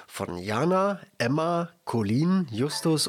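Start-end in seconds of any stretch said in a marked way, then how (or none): none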